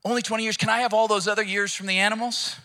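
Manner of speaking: high, eager bargaining man. Acted. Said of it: "the man's like".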